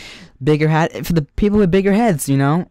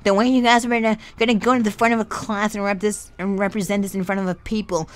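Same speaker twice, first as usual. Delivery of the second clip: lightly hammed-up teary teacher; mock teacher near tears over her hurt feelings; exaggerated teacher voice; 4 semitones higher than usual.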